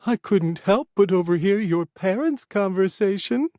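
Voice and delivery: a lightly voiced meddling old woman; mischievous old lady. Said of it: "with a small grin".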